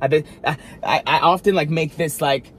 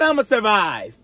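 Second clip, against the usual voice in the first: defiant declaration; 7 semitones higher than usual. Retold or imitated. Answering the song, a lightly sassy declaration. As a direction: declare it with attitude. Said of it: "I said".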